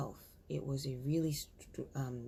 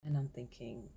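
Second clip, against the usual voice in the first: ordinary talk, nothing marked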